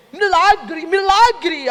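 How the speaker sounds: high, amazed cry of miracle; amazed exclamation. Retold or imitated. Lawyer hearing the story, exclaiming in high amazement. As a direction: repeat it in wide-eyed wonder.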